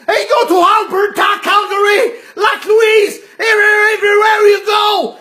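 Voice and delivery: patient shouting his Alberta ramble; frantic shout. Retold or imitated. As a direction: blurt the places in a frenzy.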